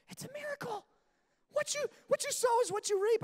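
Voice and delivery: very high, mock-awed voice; amazed revelation. Father amazed his daughter did chores, mock awe. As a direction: squeak it out in hammed-up wonder. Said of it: "I was like".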